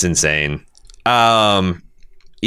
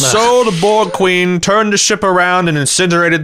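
lightly voiced Picard reading aloud; a hint of a stately Picard; 8 semitones higher than usual. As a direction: read it like a grand novelist. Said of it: "reading from his new novel".

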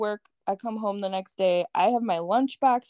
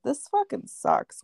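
retold griping; 12 semitones higher than usual. Retold complaint about leaving house.

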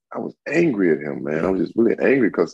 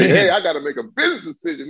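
mock scared defender; 11 semitones higher than usual. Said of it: "They're like".

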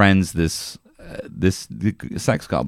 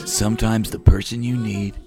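trailer narrator; 3 dB quieter than usual. Acted. Narrator teasing the goblin's arrival, trailer voice. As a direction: let it drop ominously like a film trailer.

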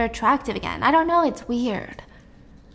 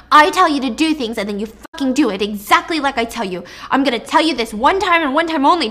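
angry berating; 3 semitones higher, 6 dB louder than usual. Acted. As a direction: lay down the law to hostages. Attacker berates his hostages.

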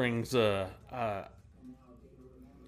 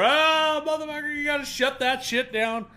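much higher panicked shout; foreman shouting; 14 semitones higher, 3 dB louder than usual. Retold or imitated. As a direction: yell at the guy smoking near the gas. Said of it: "The foreman runs over".

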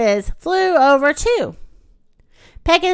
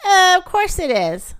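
high, slow, self-satisfied reply; smugly self-assured; 7 semitones higher than usual. A narrator's reading. Fluffy answering the little guinea pigs, smugly. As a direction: draw it out high, as if obvious. Fluffy boasts of his name.

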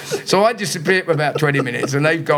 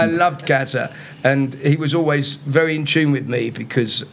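usual speaking voice

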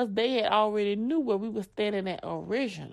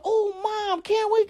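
daughter pleading; 9 semitones higher than usual. Retold or imitated.

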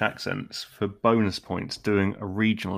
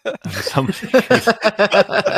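high, loud laughing slow words; 11 semitones higher, 8 dB louder, much slower than usual. Real, not acted. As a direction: giggle through it. Laughing through joke.

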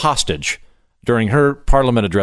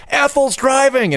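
higher, slow drawn-out call of alarm; warning call; 13 semitones higher, slower than usual. Retold or imitated. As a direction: cry out a warning to the whole street. Townspeople warning each other.

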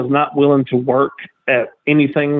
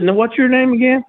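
mock sweet question; 10 semitones higher than usual. Teller feigns interest.

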